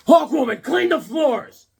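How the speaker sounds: high, loud, slow bellowed order; hammed-up master barking orders; commanding shout. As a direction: boss her around loudly. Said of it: "I go".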